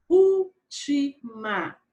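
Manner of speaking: "Última" slow and loud, in separate pieces; syllable by syllable. Shown as a demonstration. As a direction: call out úl... ti... ma. Teacher splits word into syllables. ("Última" bem devagar, separando as sílabas)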